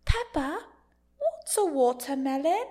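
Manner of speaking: high, drawn-out cartoon British voice; Peppa Pig voice. Babysitter amusing a little girl, high cartoon British voice. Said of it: "walk around the house and go".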